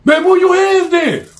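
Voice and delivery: high, loud barked order; annoyed shout. Acted. Another player shouts at him to move, loud and high. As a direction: snap at him to move his hands.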